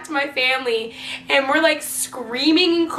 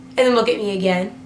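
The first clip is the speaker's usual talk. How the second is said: usual speaking voice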